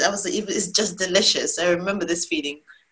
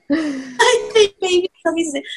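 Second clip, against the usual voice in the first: high, louder, barely contained announcement; mock non-excited; 8 semitones higher, 5 dB louder than usual. Wife telling husband baby is coming, badly faked non-excitement. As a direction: pretend to be unexcited while excitement leaks through. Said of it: "in a very non-excited voice".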